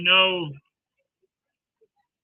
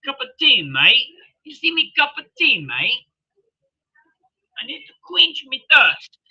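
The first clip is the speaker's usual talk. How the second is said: high, loud put-on Australian accent; 6 semitones higher, 5 dB louder than usual. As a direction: ham up an Australian bloke. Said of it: "I sound good with that Australian accent".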